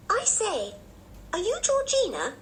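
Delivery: put-on very high-pitched, keen child; inquiring little girl. A narrator's reading. Anne to the child in the other bed, high keen inquiry. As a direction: ask brightly in a high girlish voice.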